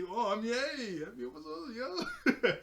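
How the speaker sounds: high-pitched, laughing mock greeting; hammed-up recovery, voice climbing sharply; overdone fake cheer. Bo covers disappointment before guests, overly cheery.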